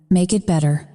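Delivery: ordinary delivery, nothing marked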